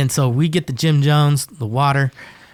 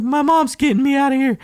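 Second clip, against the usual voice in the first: much higher, whiny sulk; whiny crying kid; 12 semitones higher than usual. Retold or imitated. Crying kid answering the inmates, much higher whine. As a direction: whine it like a scared kid pretending not to care. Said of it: "He's like".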